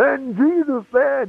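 high, slow, drawn-out preacher cry; old-fashioned preacher. Retold or imitated. Telling of a strange fervent preacher, mock old-fashioned preacher. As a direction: mimic a fervent preacher storming around the room. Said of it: "he preached like an old-fashioned preacher".

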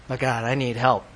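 ordinary talk

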